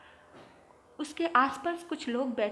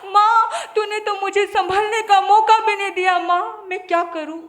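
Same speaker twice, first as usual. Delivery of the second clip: put-on 1 crying child; 7 semitones higher, 10 dB louder than usual. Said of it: "बच्चा बहुत रोता है".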